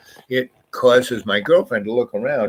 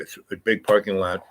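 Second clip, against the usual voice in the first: ordinary talk, nothing marked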